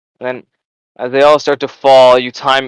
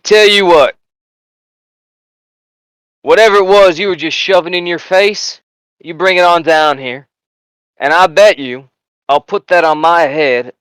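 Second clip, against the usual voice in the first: cocky sergeant wager. Acted. Sergeant daring the heckler with a wager, cocky. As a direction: taunt the heckler into the bet. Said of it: "he goes".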